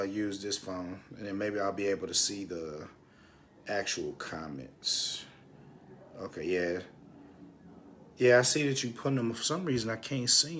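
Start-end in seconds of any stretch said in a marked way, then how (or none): 0.0-6.8 absent-minded murmur. Real, not acted. Thinking aloud while fiddling with phone.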